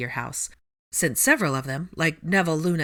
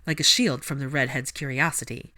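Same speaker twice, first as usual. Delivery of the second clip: ordinary delivery, nothing marked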